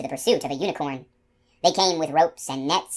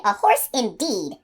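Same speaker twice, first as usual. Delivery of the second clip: slow, higher huff of contempt; scornful dismissal; 5 semitones higher, slower than usual. Acted. Unicorn leaving the gardener, slow scornful huff. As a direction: toss it off with disdain.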